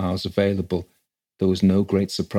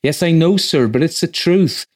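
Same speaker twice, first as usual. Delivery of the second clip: high, loud, flustered housekeeper; agitated; 8 semitones higher, 6 dB louder than usual. A narrator's reading. Housekeeper insisting to the rector it's true, agitated. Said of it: "entered his housekeeper in evident agitation".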